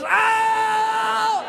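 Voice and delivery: very high, drawn-out ow; mock pain scream. Comic imitating his wife in contractions, high pained wail. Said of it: "Then she goes".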